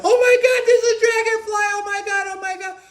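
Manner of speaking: very high, loud screaming panic; panicked girlfriend. Retold or imitated. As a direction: mimic her shrieking at the dragonfly. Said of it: "And she's like".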